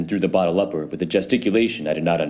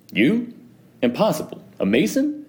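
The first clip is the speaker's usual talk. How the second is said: much higher, mocking disbelief; incredulous scoff; 12 semitones higher than usual. A narrator's reading. Fortunato scoffing at Montresor being a Mason, high and incredulous. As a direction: laugh off the very idea.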